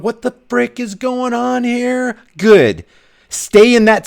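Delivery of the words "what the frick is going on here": stunned disbelief. Retold or imitated. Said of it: "If your eyes are wide open going".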